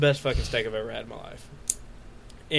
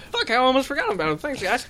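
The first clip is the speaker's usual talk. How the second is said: mock careless customer; 10 semitones higher than usual. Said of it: "I'm like".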